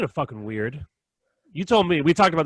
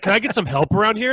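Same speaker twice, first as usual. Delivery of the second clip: higher, pushy mock customer voice; demanding customer; 8 semitones higher, 4 dB louder than usual. Shopper mistaking him for staff, higher mock demand. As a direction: play the entitled shopper demanding service.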